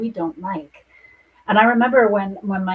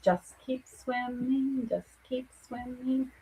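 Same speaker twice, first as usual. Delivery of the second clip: a light, higher singsong, slow and softer; Dora's singsong; 4 semitones higher, 9 dB quieter, slower than usual. Retold or imitated. Psychic quoting a cartoon fish to the client, lightly voiced singsong. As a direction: lilt it like a cheerful cartoon fish, not fully sung. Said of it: "That Dora moment".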